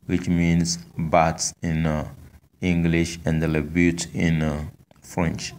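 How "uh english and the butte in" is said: ordinary talk, nothing marked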